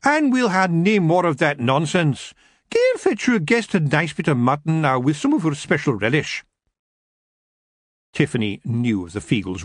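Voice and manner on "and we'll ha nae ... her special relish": a mother ending the argument; stern Kelda. Acted.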